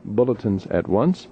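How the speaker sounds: usual speaking voice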